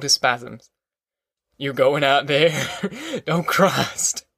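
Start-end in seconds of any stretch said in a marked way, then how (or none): 1.6-4.3 ragged man can't stop giggling at them. A narrator's reading. Ragged man giggling at the migrants' hopes, laughing. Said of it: "The giggling started again".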